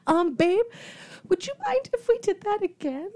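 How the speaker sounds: high, small, tentative voice; retold upset request. Girlfriend asking to redo the proposal, upset. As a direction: ask meekly, a touch upset. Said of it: "I was like, s-, upset".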